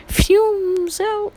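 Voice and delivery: high, loud swoosh on the words; whooshing exclamation. Retold or imitated. Storyteller showing the hero zooming out, high excited whoosh. Acting out the fast exit.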